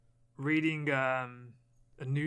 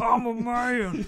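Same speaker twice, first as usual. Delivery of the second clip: put-on higher, slow, louder childish I'm a man; mock whiny echo; 9 semitones higher, 7 dB louder, much slower than usual. Co-host mimicking his friend's whiny protest, childish. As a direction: mimic the whine back at him.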